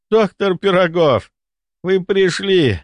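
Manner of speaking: voiced high, eager and loud; relieved baron. A narrator's reading. Weak baron greets the doctor with eager relief. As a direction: baron calls out, glad to see his doctor.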